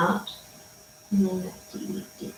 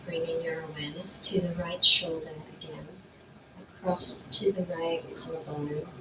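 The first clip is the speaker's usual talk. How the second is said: guided meditation; 7 dB quieter than usual. Acted. Leads body scan meditation.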